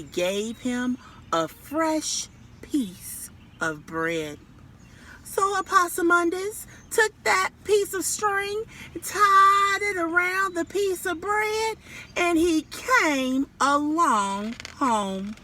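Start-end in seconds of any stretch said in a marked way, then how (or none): 5.2-15.4 high, sing-song storytelling; lightly raised read-aloud voice; lilting story narration. A narrator's reading. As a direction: tell it high like to little kids.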